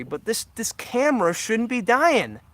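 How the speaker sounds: real anger, pushed up and loud; getting angry. Real, not acted. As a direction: vent at the dying camera, voice rising hard. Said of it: "Sorry I'm getting angry".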